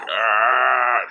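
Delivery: short imitated dog scream; loud mock dog shriek; death cry. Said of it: "That's literally how it sounded".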